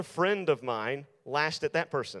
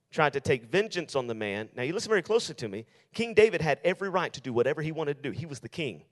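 ordinary talk, nothing marked

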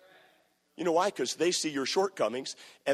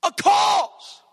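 much higher, louder, building to a shouted cry; outraged shout; 6 dB louder than usual. Acted. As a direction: preach David's outrage at full force. David's outraged protest, preached.

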